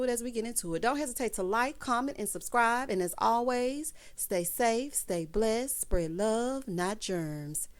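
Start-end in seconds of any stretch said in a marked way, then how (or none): none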